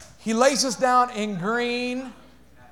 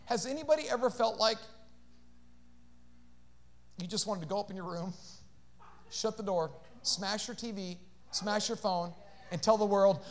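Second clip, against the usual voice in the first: normal talking voice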